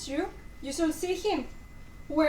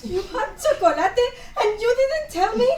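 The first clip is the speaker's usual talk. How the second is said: higher, half-laughing reproach; laughing mock hurt; 8 semitones higher, 5 dB louder than usual. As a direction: play at being betrayed.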